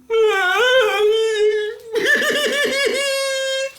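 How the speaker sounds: high, loud sobbing wail; a lonely woman howling with grief. A narrator's reading. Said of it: "a woman crying".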